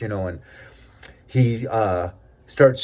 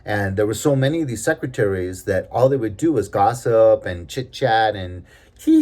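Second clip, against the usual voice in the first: ordinary delivery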